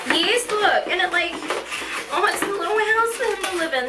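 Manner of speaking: high, bubbly pointing out; eager to show it off; excited showing. Real, not acted. Showing viewers the bear house, excited and high.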